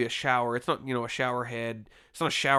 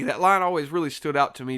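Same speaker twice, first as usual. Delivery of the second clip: ordinary talk, nothing marked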